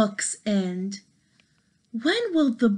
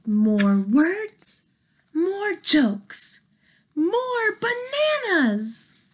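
building plea; 7 semitones higher, slower than usual. Acted. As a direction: build up each more. Piggy pleading, building up.

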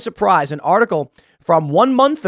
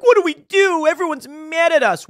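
much higher, louder mock panic; high mock-panicked corporate voice; panicked execs; 12 semitones higher, 4 dB louder than usual. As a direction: squeal it like a flustered exec. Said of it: "And they're like".

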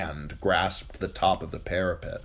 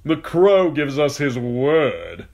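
mocking knight; 8 semitones higher, 9 dB louder, slower than usual. A narrator's reading. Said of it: "The knight ... laughed".

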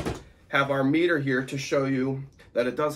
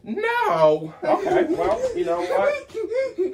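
mock surprised; 5 dB louder, much slower than usual. Mock shock at son's answer.

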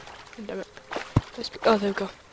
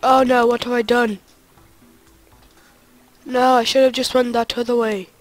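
loud, regretful outcry; loud dismay; 2 semitones higher, 8 dB louder than usual. Real, not acted. Player regretting a wrong turn, loud dismay. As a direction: cry out in dismay at your own mistake.

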